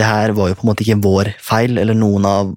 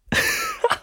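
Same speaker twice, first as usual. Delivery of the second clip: laugh; 8 dB quieter than usual. Real, not acted. Laughing fit.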